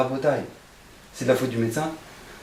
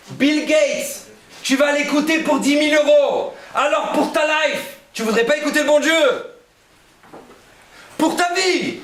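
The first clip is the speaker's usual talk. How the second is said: much higher and louder, pressing the crowd; preaching at full force; 11 semitones higher, 4 dB louder than usual. Acted. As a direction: cry out the challenge to the crowd. Preacher's rising exhortation. (prêche enflammé, beaucoup plus aigu et fort)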